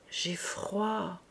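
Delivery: soft, small reply; understated, pitiful murmur; feeble child. Acted. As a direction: plead quietly out of the cold. Freezing child answers meekly.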